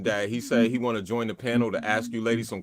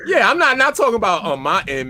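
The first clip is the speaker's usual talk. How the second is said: loud protest; 10 semitones higher, 10 dB louder than usual. Real, not acted. Protesting a misunderstanding.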